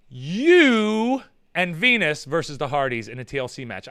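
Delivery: ordinary talk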